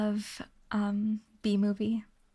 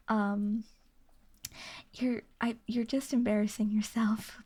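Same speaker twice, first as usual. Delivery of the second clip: whispering. Real, not acted. Whispered scolding to chat.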